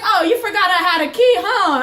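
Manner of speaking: loud, high, laughing gotcha; gloating taunt. Retold or imitated. Reopening the locked door on the roommate, loud laughing taunt. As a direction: crow over him with a laugh. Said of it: "I was like".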